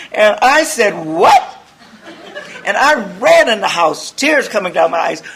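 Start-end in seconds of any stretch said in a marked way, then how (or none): 1.1-1.4 shocked what. Retold or imitated. Shocked reply.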